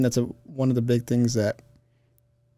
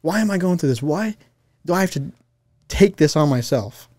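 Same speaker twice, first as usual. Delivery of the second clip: higher, pleading mock complaint; blaming God; 6 semitones higher than usual. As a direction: imitate someone questioning God in protest. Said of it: "blaming God".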